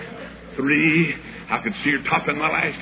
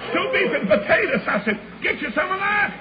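rising excitement. Acted. Preacher retelling the meal, voice climbing in excitement. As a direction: pitch climbing higher, building preacher fervor.

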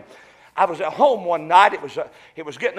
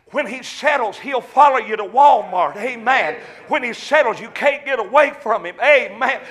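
loud, high-pitched revival preaching; preaching at full force; 6 semitones higher, 8 dB louder than usual. Acted. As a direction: exhort them, building to a cry. Preaching, exhorting the congregation.

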